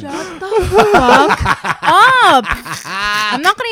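slow, drawn-out laughing protest; laughing retort. Real, not acted. Answering the beached whale jab, slow laughing protest. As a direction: stretch it out through the laugh.

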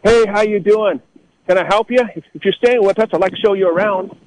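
high, sugary mock-hospitable voice; hotel staff easing a local out, high sweet tone; imitating polite staff. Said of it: "They go, what do they say?".

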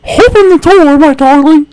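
high, loud hammed-up husband voice; over-the-top, high-pitched plea; pleading husband. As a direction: call urgently in a put-on high voice.

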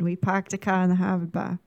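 put-on low-key Boston accent, dropped r's. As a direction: toss it off like a Bostonian. Repeats Boston accent joke.

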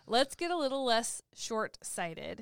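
slow, measured coach delivery; calm slow life coach line. Shown as a demonstration. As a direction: spell it out slowly like a life coach. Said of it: "Oh, like that?".

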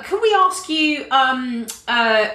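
ordinary talk

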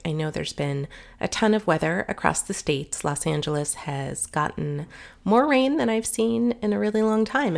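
ordinary talk

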